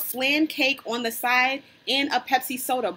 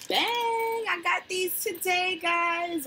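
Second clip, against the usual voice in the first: excited reveal; 9 semitones higher, slower than usual. Real, not acted. Showing off gift flowers.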